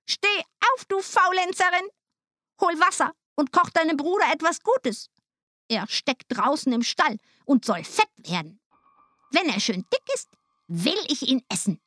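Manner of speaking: high-pitched, bossy witch voice; witch calling out. A narrator's reading. Witch shaking Gretel awake, high commanding call. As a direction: order her about as a wicked witch. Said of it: "rüttelte sie wach und rief".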